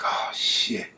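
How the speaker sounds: breathy whispered oh shit; a suspect cursing to himself as he gets identified, whispered; whispered curse. Retold or imitated. Victim accuses him to police.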